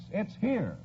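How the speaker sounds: ordinary talk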